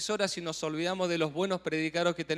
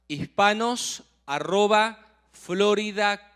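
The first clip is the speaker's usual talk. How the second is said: slow dictation; 6 dB louder, slower than usual. Real, not acted. Host repeating the email for viewers, slow and clear. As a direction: spell it out slowly and clearly, word by word.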